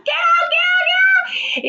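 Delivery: very high frantic yelling; screaming. Retold or imitated. Woman chasing the spider from the tub, high panicked yell. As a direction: shriek it over and over. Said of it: "running around the bathtub, like".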